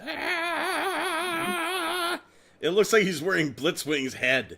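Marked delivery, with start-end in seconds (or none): 0.0-2.2 long, high held cry; drawn-out high wail at ordinary volume; mock scream. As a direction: imitate a screaming face, pitched way up. Voicing the toy's screaming face.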